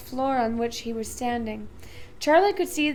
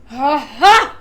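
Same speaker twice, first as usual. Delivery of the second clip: loud, delighted ha ha; gleeful laugh; 15 dB louder than usual. A narrator's reading. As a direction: laugh with mischief.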